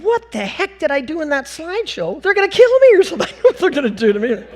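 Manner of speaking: high-pitched comic panic; hammed-up freaking out. Speaker driven up a dirt road by strangers, comic panic. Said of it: "I'm really freaking out".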